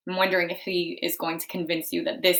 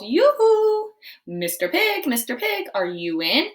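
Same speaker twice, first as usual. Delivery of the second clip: high loud yoo-hoo call; 8 semitones higher, 6 dB louder, slower than usual. Acted. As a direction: sing it out to the door. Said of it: "I called".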